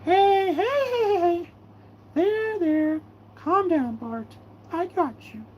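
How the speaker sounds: calm him down gently; soothing. Acted. Soothing Bart in play.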